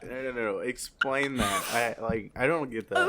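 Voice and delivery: loud, high-pitched real shock; shocked disbelief. Real, not acted. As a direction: burst out demanding an explanation. Shocked by the fetish reveal.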